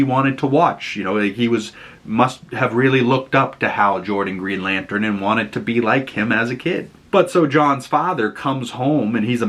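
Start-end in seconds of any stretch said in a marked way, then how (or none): none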